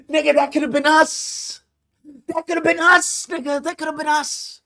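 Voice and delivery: high, wailing regret; mock lament. Real, not acted. To viewers about the money, high mock lament. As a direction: mourn the missed chance.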